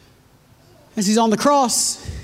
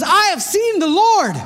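joyful announcement; 6 semitones higher than usual. Acted. Voicing Mary announcing the risen Lord.

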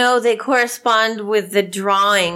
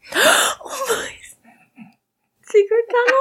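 much higher, thrilled; excited gasp. Real, not acted. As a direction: gasp with delight. Player gasps at the tunnel.

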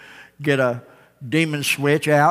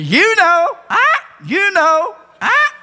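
very high, slow, drawn-out squawk; knowing parrot; 6 dB louder, slower than usual. Acted. Parrot cocking its head at the passerby, high sly squawk. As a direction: let the parrot hint slyly, stretched high.

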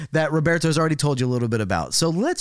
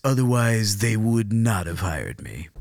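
lower, measured presenter voice; silky-smooth broadcaster, pitched down; 5 semitones lower, slower than usual. Acted. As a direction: play a self-assured TV presenter with a silky voice. Character insists on silky smooth voice.

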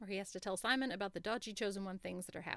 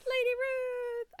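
high, loud, drawn-out squeal of the name; delighted squeal; 8 dB louder, much slower than usual. Real, not acted. Fan squealing about her favourite character, slow and high. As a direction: stretch the name out in pure delight.

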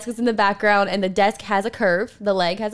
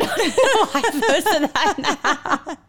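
laughing; 7 semitones higher than usual. Real, not acted. Laughing at her own photo.

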